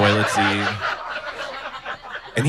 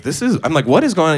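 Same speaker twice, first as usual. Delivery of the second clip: confused question; 7 semitones higher, faster than usual. Retold or imitated. Confused about toilet seat.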